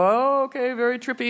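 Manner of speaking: mock stoner reaction. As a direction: float it up like a stoned surfer.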